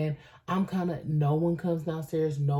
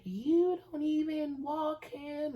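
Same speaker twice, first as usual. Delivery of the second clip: much higher, slow mocking girlfriend voice; 12 semitones higher, slower than usual. Narrator mocks the girlfriend's complaint, high and slow. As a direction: sneer it in her whiny high voice.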